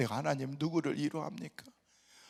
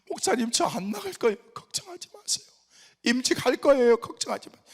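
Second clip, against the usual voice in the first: mock tearful, higher voice; tearful plea; 8 semitones higher, 9 dB louder than usual. Deacon tearfully promises the pastor to stay, mock tearful. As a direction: play the crying deacon lightly. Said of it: "눈물을 뚝뚝 흘리면서".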